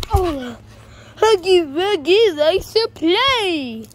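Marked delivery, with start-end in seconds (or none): 1.2-3.9 higher, louder, slow sinister monster voice; creepy monster voice. Pretend. Huggy Wuggy plushie taunting the others, slow creepy monster voice. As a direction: drawl it like a toy monster coming to play.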